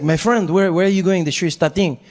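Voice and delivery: mock French-accented English. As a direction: play a friendly, puzzled Frenchman with a French accent. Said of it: "the French guy turns to me".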